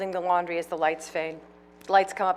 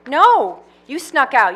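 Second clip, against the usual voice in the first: scolding; 7 semitones higher, 12 dB louder, slower than usual. Acted. Pauline scolds Louise.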